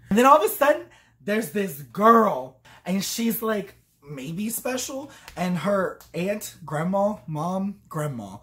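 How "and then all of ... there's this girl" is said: ordinary talk, nothing marked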